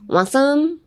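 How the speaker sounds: "Want some?" slurred into one word, lifting high; slurred together. Shown as a demonstration. Teacher telling learners to slur their words, slurred together. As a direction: run "Want some?" together with no gap, rising. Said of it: "literally slur your words".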